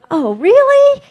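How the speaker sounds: high, squeaky disbelief; surprised. Retold or imitated. Woman told to go elsewhere again, high surprised squeak. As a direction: act caught off guard by the order. Her surprised reply to Spirit.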